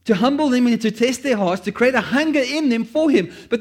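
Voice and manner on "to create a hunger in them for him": ordinary talk, nothing marked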